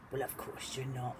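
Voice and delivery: ordinary delivery, nothing marked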